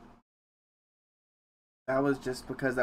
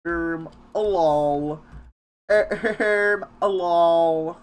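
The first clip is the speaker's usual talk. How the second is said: louder, higher, slow mock announcement; mock pompous; 4 semitones higher, 9 dB louder, much slower than usual. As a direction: say it like a grand joke. Joking repeated lol, theatrical.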